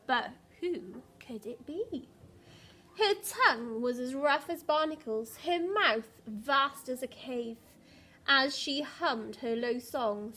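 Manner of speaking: put-on lively read-aloud for young children; storybook reading voice; story narration. A narrator's reading. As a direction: tell the story to a class, bright and rhythmic. Reads picture book aloud.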